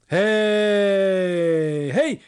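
loud, high heave-ho cries; rally each other to haul the boat; calling out. A narrator's reading. Farmhands urging each other on, loud calls. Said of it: "zachęcali się parobcy".

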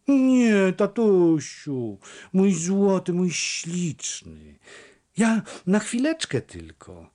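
put-on girl pleading with her father, sweet and high; pleading daughter. A narrator's reading. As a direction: melt the old man with pleading.

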